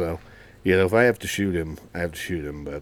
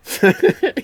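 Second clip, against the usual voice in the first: loud squealing laugh, pitch way up; sudden high real laugh; high burst of laughter; 10 dB louder than usual. Real, not acted. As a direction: crack up loudly at the punchline.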